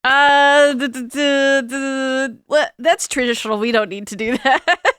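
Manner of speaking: long stuttering stall, then brushing it off; acted caught-off-guard fluster; flustered stammer. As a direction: stall on repeated syllables, then dismiss it. Stammering when asked about rings.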